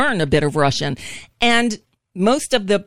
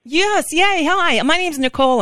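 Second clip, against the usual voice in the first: high, delighted little cheer; excited; 10 semitones higher than usual. Real, not acted. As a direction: burst out with relief and joy. Relief someone speaks English.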